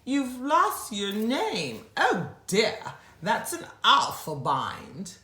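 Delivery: lightly voiced fretful elephant; crying beast. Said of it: "who cried".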